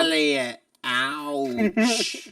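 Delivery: high, strange alien whine. Retold or imitated. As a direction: squeak it like E.T. Continuing the E.T. bit.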